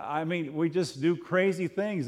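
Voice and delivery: normal talking voice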